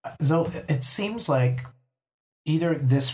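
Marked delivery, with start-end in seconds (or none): none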